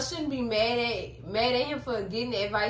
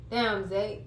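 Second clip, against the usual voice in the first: ordinary delivery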